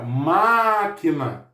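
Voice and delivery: "máquina" with MÁ stretched out; stress on MÁ. Shown as a demonstration. Teacher presenting the word to pupils, long stressed MÁ in "máquina". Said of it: "a sílaba tônica é o ma".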